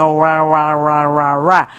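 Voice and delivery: lightly sing-song "ra, ra, ra" to show how English slides; mild demo of smooth English "ra, ra, ra"; gliding syllables. Shown as a demonstration. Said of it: "permite que uno diga".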